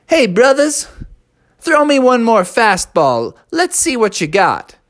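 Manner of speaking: put-on high, buddy-buddy kid voice; young bear hyping his friend; cocky kid ballplayer. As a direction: talk trash to your teammate. Bubby calls to teammates.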